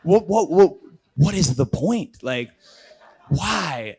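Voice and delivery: imitating his own stunned protest at the stunt; incredulous sputter. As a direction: sputter in disbelief. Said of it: "I'm like".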